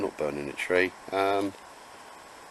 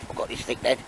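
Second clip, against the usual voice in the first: voicing the dog; 4 semitones higher than usual. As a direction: give the dog a hushed, cheeky little voice. Owner voicing his dog.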